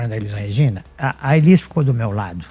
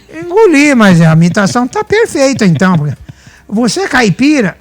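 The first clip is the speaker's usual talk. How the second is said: high and louder, amazed; an impressed friend marvelling at the imitation, raised and loud; retold amazed admission; 9 semitones higher, 6 dB louder than usual. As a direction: replay Lira's delighted disbelief. Lira admits he was fooled.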